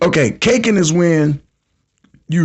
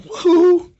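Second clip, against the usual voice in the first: mock woo-hoo; 13 semitones higher than usual. Women reacting to the prank.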